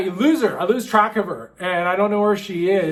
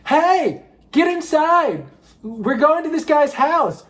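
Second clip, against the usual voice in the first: much higher, calling out; calling from a truck; 8 semitones higher than usual. Retold or imitated. Drunk friend calling from truck, high call out. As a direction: yell it out a truck window. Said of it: "her head comes out the back like".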